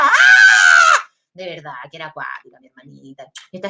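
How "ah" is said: grito corto y fuerte, agudo; grito imitado. Retold or imitated. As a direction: suelta un grito como la hermana loca. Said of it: "gritaba como loca". (grito histérico de hermana, imitado)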